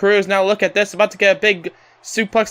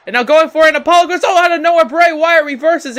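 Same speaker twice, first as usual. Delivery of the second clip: louder, higher play-by-play rush; excited commentary; 7 semitones higher, 5 dB louder, faster than usual. Real, not acted. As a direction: call the reversal with rising excitement. Play-by-play climax of reversal.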